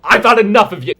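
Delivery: sudden furious shout, high and very loud; exasperated outburst. Acted. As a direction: explode at someone you are sick of. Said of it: "Sorry for that outburst".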